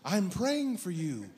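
mild pastoral sweetness; polite concern. Acted. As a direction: say it gently, as a restrained message. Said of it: "send her a message and say".

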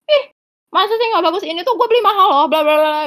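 spray it out in a quick angry burst; angry retort. Retold or imitated. Offended partner snapping back about the outfit, fast and angry. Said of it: "Misalnya marahnya adalah".

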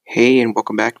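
talking around a retainer; wearing a retainer. Real, not acted. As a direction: mouth full of retainer. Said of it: "I can't say it with my".

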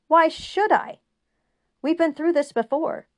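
high, loud bitter shout; angry outburst. A narrator's reading. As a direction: throw the question back at her, furious. Julian bursts out at counselor.

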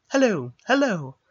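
a robin teasing the man from the sky; chirping bird. Acted. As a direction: sing it out like a playful robin. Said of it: "it chirped as it flew above him".

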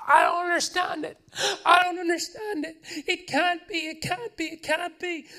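put-on high, loud wailing child voice; crying toddler. Toddler cries because ice cream cannot be warm, high wailing. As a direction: wail it like a heartbroken little girl. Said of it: "And she starts crying".

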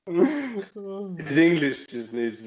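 slow, lightly imitated Yorkshire accent; a mum's proud Yorkshire voice, mildly done; mild mock Yorkshire accent. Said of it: "in a lovely Yorkshire accent".

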